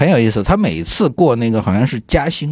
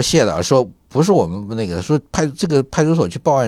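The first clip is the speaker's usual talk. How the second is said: usual speaking voice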